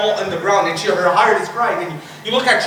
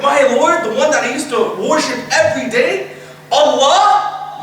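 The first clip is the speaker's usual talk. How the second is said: eager recognition; 6 semitones higher than usual. Acted. Soul answering who its Lord is, eager recognition. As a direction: lift each phrase up as if amazed to be asked.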